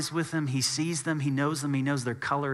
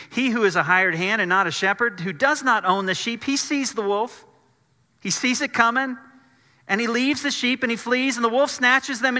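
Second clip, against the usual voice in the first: raised, ringing Bible reading; scripture reading; 7 semitones higher, 4 dB louder than usual. Acted. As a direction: proclaim the Gospel passage to the congregation, higher and louder.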